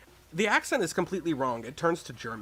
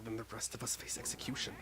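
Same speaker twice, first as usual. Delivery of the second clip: whisper; 2 semitones lower, 9 dB quieter than usual. Acted. Grim rule read out.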